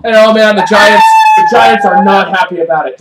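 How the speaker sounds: usual speaking voice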